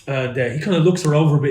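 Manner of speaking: usual speaking voice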